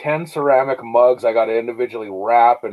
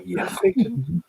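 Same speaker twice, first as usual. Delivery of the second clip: mock meek reply; 3 semitones higher, 7 dB quieter, slower than usual. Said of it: "Frankie said".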